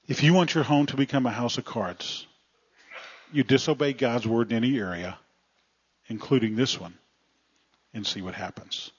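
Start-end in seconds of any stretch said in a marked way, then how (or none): none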